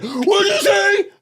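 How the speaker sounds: put-on high, slow shocked yell of a girl; shocked shout. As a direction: shriek it out in disbelief. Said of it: "They said".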